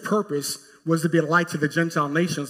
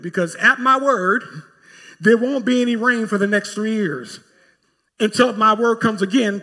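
louder, higher prophetic warning; prophet confronting the king; 5 semitones higher, 5 dB louder than usual. Acted. Prophet declaring drought to Ahab.

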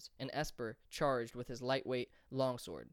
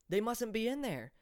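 higher, raised protest; yelling; 9 semitones higher, 3 dB louder than usual. A narrator's reading. Nezo crying out to his friends about the children, raised and higher. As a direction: call it out as you stand up. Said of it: "Nezo yelled".

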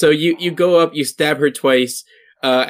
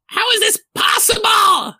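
very high, shrieking hag voice; shocked hag. Acted. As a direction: cry out in outraged disbelief as a hag. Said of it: "this hag looks at, um, looks at Epi and says".

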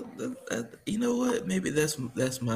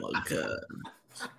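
whispered; 16 dB quieter, much slower than usual. Real, not acted. Exasperated at being teased.

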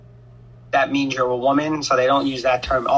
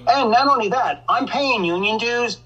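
normal talking voice

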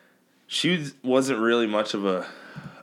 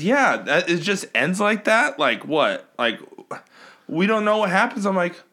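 high, louder back-and-forth; heated mock argument; 9 semitones higher, 3 dB louder than usual. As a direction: argue the ending with exasperation. Heated quoted argument over ending.

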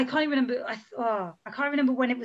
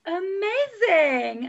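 excited; 7 semitones higher, much slower than usual. Real, not acted. Cheerful host outro.

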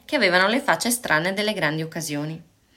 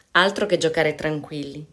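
ordinary delivery

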